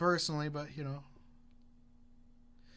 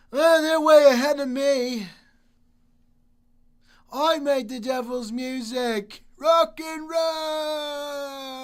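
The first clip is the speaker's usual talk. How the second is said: loud, slow rocker yell; 11 semitones higher, 10 dB louder, much slower than usual. Retold or imitated. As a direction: belt it like a rocker. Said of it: "[name] is probably just like".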